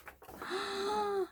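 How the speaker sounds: long, high "ah" of wonder; awestruck gasp. Real, not acted. Crafter turns the page of somebody's scrapbook, awestruck gasp.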